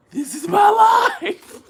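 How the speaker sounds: high, loud, slow lament; wailing. Real, not acted. Lamenting her life to viewers, loud wail. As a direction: wail it at the sky.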